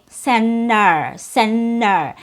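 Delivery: "center" as "cen-ner", both syllables equally heavy and drawn out; equal syllable stress. Shown as a demonstration. Teacher showing learners what sounds wrong, equal stress. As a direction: say "center" without T, slowly, equal force on each syllable. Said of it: "can't make your syllables with equal force".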